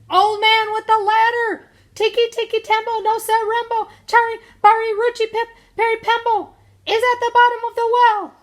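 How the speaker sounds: very high, loud, sustained yell; little boy hollering the whole name at full volume; desperate shout. A narrator's reading. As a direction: shout it with your last bit of breath. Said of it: "he shouted".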